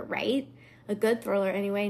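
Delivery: usual speaking voice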